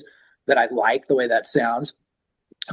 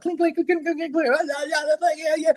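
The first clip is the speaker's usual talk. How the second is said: imitated clinks. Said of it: "people playing guitars unplugged".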